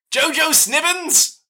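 high, drawn-out startled cry of the name; a tiny gnome woman calling out in surprise, stretched slow. Acted. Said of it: "small gnome woman who says".

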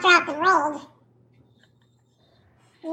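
helium voice. Acted. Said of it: "The helium voice is perfect".

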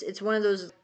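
ordinary talk, nothing marked

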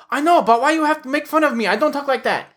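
high-pitched imitated Filipino accent; mock-offended Filipino-accented wife. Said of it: "a Filipino accent because his wife is".

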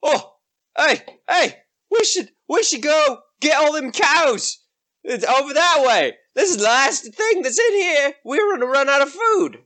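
high-pitched dim ogre voice; eager ogre. Acted. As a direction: urge your mate on as an ogre. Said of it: "he turns to his friend and he's like".

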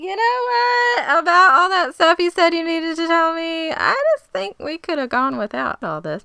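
high, giggling put-on voice; laughing as she voices the stunned friend; laughing mock reply. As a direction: squeak it out through laughter.

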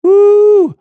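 high, loud joyful woo; thrill seeker whoop. Retold or imitated. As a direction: whoop from the top of the ride. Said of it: "Only the thrill seeker is saying".

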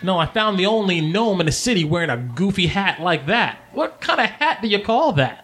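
high, scornful gnome woman; female gnome, mocking. Gnome woman to stranger in a goofy hat, mocking. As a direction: needle them in a high gnome voice.

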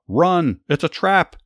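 very high, loud warning cry; panicked yell. Acted. King Joram turning his chariot to warn his nephew, panicked yell. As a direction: scream the warning as you flee. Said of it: "yelled to his nephew".

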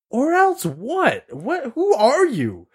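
high, drawn-out incredulous retort; defiant challenge. Retold or imitated. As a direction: square up to him in disbelief. Acted quarrel with the kid.

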